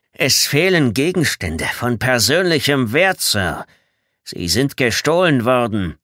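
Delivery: loud, slow, emphatic military report; indignant soldier's complaint. Acted. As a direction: state the accusation slowly and loudly. Bedford accuses before his commander.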